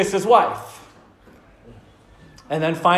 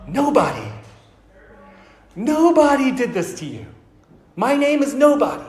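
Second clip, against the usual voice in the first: yelling back; 6 semitones higher than usual. Retold or imitated. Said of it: "He yells back at the Cyclops".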